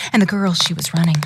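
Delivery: ordinary delivery, nothing marked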